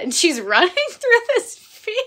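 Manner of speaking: high, loud laughing narration; telling the sunset story, laughing loudly; laughing while telling. Real, not acted. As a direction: laugh through the words. Laughing while retelling mom.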